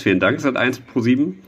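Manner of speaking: ordinary talk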